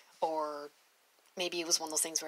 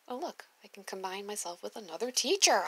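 ordinary delivery

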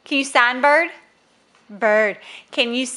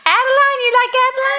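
high, loud, delighted echo; 12 semitones higher, 3 dB louder, much faster than usual. Real, not acted. Parent echoes sister's name to Elise, high excited voice.

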